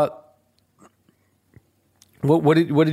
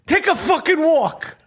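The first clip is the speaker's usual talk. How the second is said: Danny Aiello impression; 9 semitones higher than usual. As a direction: play Danny Aiello chewing out tourists. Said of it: "I'm doing my Danny Aiello".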